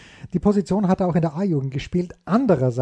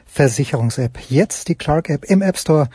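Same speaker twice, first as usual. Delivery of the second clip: ad read; 4 semitones lower, 3 dB louder than usual. Acted. Host reads sponsor ad.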